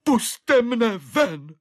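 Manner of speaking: sudden high desperate roar. Acted. Desperate prisoner roars to be let out. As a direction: bellow it out, slow and pleading. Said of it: "náhle zařval".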